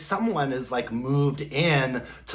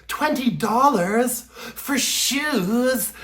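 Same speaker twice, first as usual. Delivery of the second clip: high, slow, incredulous question; mimicked Carrie; 7 semitones higher, much slower than usual. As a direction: draw it out in mock shock. Carrie shocked at the price.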